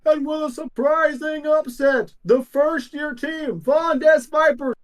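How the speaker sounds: high, booming sports announcer. Acted. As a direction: call it like a stadium announcer. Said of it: "Announcer voice comes over".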